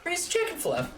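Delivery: high cutesy praise; sweet high take. Shown as a demonstration. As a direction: lift it way up to sound nice. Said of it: "No, like".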